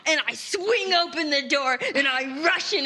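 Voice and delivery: excited. Acted. Rushing to hug friend.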